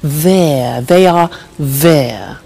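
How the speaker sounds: There, they are there, said slowly and clearly with the vowel of "there" drawn out; slow, exaggerated "there"; careful pronunciation. Shown as a demonstration. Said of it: "quite a difficult pronunciation".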